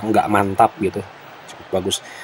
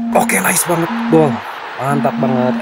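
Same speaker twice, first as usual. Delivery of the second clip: excited; 5 semitones higher than usual. Real, not acted. His team scores a goal.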